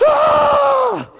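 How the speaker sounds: high, loud horrified shriek; collective gasp. Retold or imitated. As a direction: shriek like the whole room just cringed. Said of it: "and everybody in here goes".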